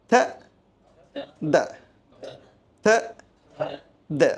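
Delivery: থা with a voiceless TH, দা with a voiced one; TH sound demo. Shown as a demonstration. Teacher modelling the two English TH sounds, isolated TH demo. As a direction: alternate breathy-free থা and voiced দা, each alone. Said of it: "ইংলিশে টিএইচ এর ক-, উচ্চারণ কখনো থা এর মতো".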